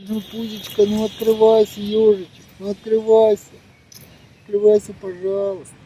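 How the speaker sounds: high, soft pleading coax; coaxing hedgehog. Real, not acted. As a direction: gently beg the hedgehog to open up.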